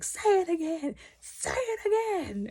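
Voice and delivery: high, slowing dare; mock menacing challenge. Harwin daring the mentor to repeat it, menacing. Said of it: "He was like".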